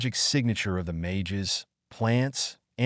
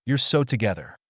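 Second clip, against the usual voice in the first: ordinary delivery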